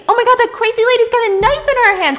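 high, loud, rushed panic; panicked bystander. Acted. Startled pedestrians spot a knife in the crosswalk, panicked cry. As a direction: cry out in alarm, words tumbling fast.

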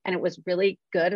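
ordinary delivery

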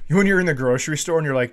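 normal talking voice

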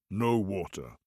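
low, slow booming giant. Acted. Giant woken from sleep, slow deep rumble. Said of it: "giant voice".

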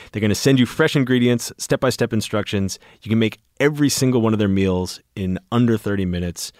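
upbeat sponsor read, brisk and bright. Acted. Host reading a sponsor spot to listeners, upbeat ad read. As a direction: sell the meal kit like a podcast ad.

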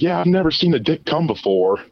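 imitating her curiosity; girl's voice. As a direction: say it up high, curious. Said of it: "It's like".

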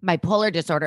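ordinary talk, nothing marked

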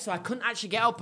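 ordinary talk, nothing marked

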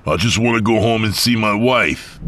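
high, loud desperate plea. Acted. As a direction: plead in panic. Mike desperate.

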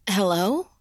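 calling out, worried and uneasy; a nervous call into the dark. Acted.

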